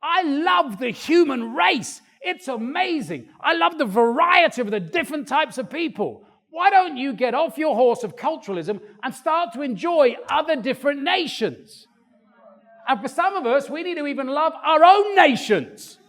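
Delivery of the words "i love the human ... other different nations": a delighted creator marvelling at variety; voicing God. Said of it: "He goes".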